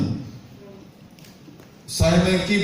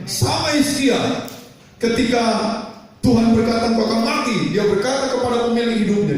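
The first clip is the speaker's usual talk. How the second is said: raised, high pulpit voice; impassioned preaching; 8 semitones higher than usual. Real, not acted. Preacher retells Hana's weeping prayer, impassioned and high-pitched. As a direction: drive the story home in long rising phrases.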